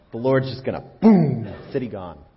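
loud, gleeful boom; mock explosion shout. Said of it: "He figured".

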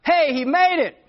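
high, loud friendly holler; hollering to a neighbor. Retold or imitated. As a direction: call out across the street, high and loud. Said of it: "I hollered at the neighbor".

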